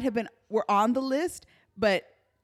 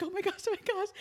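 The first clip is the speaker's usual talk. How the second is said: high-pitched retold panic; freaking out; 9 semitones higher, 4 dB quieter, much faster than usual. Driver in her sister's car, high retold panic. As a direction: squeak it in acted alarm. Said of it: "I was freaking out".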